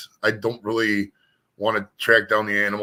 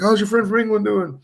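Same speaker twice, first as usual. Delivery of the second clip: higher, friendly imitation of a bartender; bartender asking; 11 semitones higher than usual. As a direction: imitate the bartender's sweet question. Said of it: "She's like".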